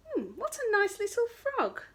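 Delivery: high, cooing wolf; a wolf gushing to himself; sweet thought. Acted. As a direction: coo it like a charmed wolf.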